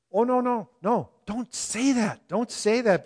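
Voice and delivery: high, loud alarmed pleading; alarmed protest. Retold or imitated. Preacher imagines himself warning the brother, high alarmed pleading. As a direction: beg him not to say it, alarmed. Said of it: "I'm sitting there going".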